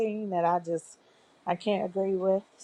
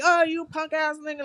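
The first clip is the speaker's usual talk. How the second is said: mock insult; 9 semitones higher than usual. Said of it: "Not in a way that's like".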